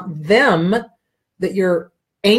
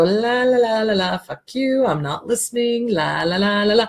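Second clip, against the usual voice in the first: mocking la la la; 3 semitones higher than usual. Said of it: "the person's gonna go".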